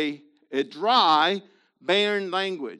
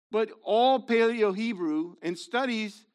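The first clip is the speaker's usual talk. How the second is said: usual speaking voice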